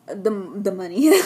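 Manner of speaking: real voice slip with a laugh; losing voice. Real, not acted. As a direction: voice giving out into a laugh. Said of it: "I'm losing my voice today".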